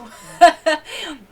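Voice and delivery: high burst of laughter; a sudden loud laugh. Real, not acted. Laughing loudly about the demons that scared her.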